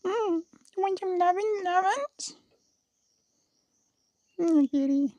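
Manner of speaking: very high, soft cooing pet voice. Real, not acted. Vlogger petting the cat, soft high baby talk. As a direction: coo softly and high to the cat.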